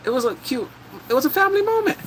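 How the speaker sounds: high, sweet gushing. Real, not acted. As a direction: coo over the cute moment. Gushing over cute scene.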